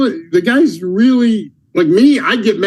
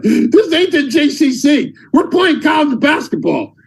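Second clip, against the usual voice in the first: high-pitched imitation of a furious coach; mock screaming coach; 8 semitones higher than usual. Coach scolding his college player, retold mock screaming. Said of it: "he's screaming at me".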